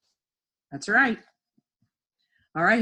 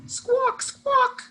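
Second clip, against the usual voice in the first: very high-pitched bird squawk; 4 dB louder than usual. Pretend. Teacher playing the rooster from the sentence, squawking. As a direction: screech it like a silly rooster.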